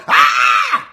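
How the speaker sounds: loud, short "Ah!" scream. Retold or imitated. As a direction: let out a sharp holler.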